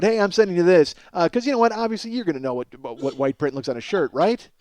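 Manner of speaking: ordinary talk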